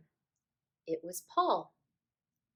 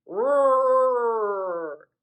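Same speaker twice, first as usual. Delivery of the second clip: roar; 3 semitones higher, 10 dB louder than usual. A narrator's reading. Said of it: "Bill said".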